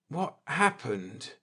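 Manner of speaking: groggy words through a dazed haze; thick-voiced. Injured Akers waking on the asteroid, thick-voiced. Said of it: "asked Akers thickly".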